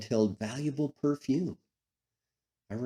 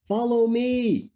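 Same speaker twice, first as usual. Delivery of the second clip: loud, high, drawn-out call; calling from the shore; 12 semitones higher, 6 dB louder, slower than usual. A narrator's reading. Man on the shore calling to fishermen in a boat, loud high call. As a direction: call it out across the water, stretching the words. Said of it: "came a voice from the shore".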